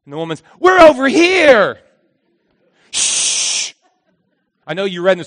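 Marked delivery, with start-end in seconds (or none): 0.6-1.8 higher, louder, slow sing-song reply; cheerfully giving away the hiding spot; clueless calling out; naive call. Acted. Eve naively answering God. 2.9-3.7 unvoiced hiss for silence; sharp whispered shh; hushing shh. Hiding hush.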